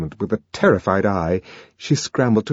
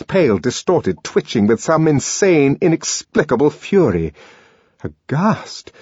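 plain reading voice

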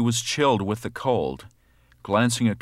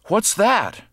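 high frightened cry; startled cry; 12 semitones higher, 4 dB louder than usual. A narrator's reading. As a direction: jump out of sleep, scared. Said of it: "cried the old woman".

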